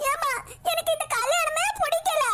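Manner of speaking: tearful bride. Acted. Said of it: "ஏன்டி இப்படி கண்ணீர் வடிச்சு நிக்க".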